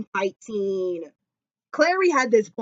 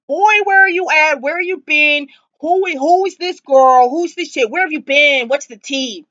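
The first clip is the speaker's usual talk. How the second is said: higher, louder sassy scolding; sassy scolding girlfriend; 5 semitones higher, 4 dB louder than usual. Retold or imitated. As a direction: demand answers like a sassy girlfriend.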